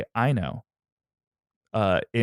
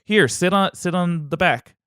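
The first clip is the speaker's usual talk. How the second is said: Phrixus voice; 9 semitones higher than usual. Acted. Voicing Phrixus in skit.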